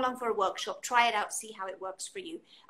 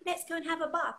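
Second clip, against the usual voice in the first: put-on higher, inviting a baby to bath time; talking to a baby; 7 semitones higher than usual. Shown as a demonstration. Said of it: "so say".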